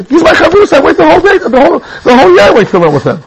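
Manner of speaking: mock indignant protest. Rabbi correcting the MC before the guests, heated mock protest.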